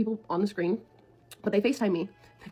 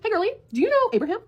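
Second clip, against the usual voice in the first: lightly voiced girly texter, pitched up and quick; texter's chirpy opener; 9 semitones higher, faster than usual. Stranger texting the boyfriend's partner out of nowhere, girly and high. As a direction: put on a high, quick messaging voice.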